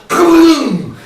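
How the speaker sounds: loud, high booming noise; imitated explosion boom. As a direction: boom like speakers blowing through the hall. Mimics speakers blasting the hall.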